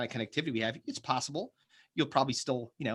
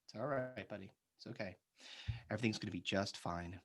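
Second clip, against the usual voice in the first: very soft, low bedtime comforting; soothing bedtime reassurance; 5 semitones lower, 15 dB quieter than usual. Pretend. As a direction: hush it low, as if tucking someone in. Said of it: "tuck me in and tell me it's gonna be okay".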